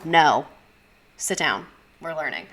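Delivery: a loud, low, flat refusal; stern no. Pretend. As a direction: shut the kid down at once. Teacher refusing imagined student.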